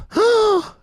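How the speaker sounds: loud, high scandalized gasp; mock shocked gasp. Reacting to the man saying damn, mock shocked gasp. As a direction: gasp in hammed-up horror.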